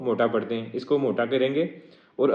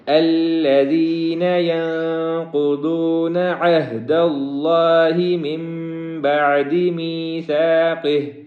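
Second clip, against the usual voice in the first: slow melodic Quran chant; chanted Quranic recitation, long and melodic; tajweed recitation; 3 semitones higher, 5 dB louder, slower than usual. Shown as a demonstration. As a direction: draw each word out in sung recitation. Full verse recitation.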